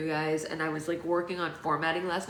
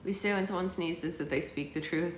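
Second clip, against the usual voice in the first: usual speaking voice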